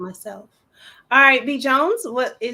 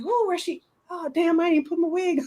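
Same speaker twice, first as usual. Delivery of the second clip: panicked realization; 6 semitones higher than usual. Retold or imitated. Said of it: "'cause I was like".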